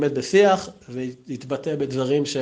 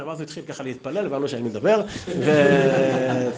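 normal talking voice

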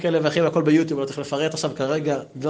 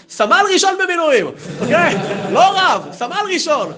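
high, loud, insistent declaration; proud loud correction; 13 semitones higher, 6 dB louder than usual. Retold or imitated. Rabbi correcting the students about his army rank, loud and proud. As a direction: set them straight, chest out.

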